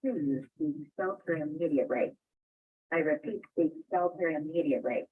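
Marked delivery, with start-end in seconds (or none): none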